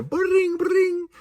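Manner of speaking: high imitated ringing; ringing phone sound. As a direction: mimic the phone ring. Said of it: "phone ringing".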